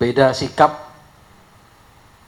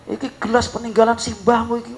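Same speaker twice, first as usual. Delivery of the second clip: high, sharp scolding father; imitating angry father; 9 semitones higher than usual. Father scolding his son over the broken glass, mock angry snapping. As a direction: snap at the kid like a furious dad. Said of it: "Langsung bapaknya bentak-bentak".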